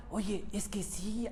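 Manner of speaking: hushed whisper to God; whispered aside, slowed; whispered prayer. Pretend. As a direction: breathe it out like a secret confession. Imagined inner prayer to God.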